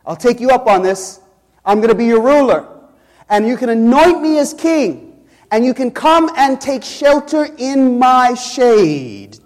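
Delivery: preacher voicing the bramble's offer, a touch cocky; boastful bramble. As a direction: lightly voice the bramble grabbing the crown. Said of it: "And the bramble says".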